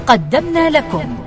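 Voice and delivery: usual speaking voice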